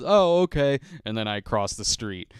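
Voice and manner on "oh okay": resigned reply. Retold or imitated. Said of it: "She just goes".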